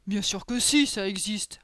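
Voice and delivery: put-on ogre character, reassuringly certain; assured ogre. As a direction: answer firmly as the big ogre. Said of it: "répondit Boris".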